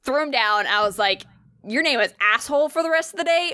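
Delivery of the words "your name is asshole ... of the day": raised-pitch mock-cross telling-off; exasperated scolding. Owner telling off the cat just rescued, exasperated scolding.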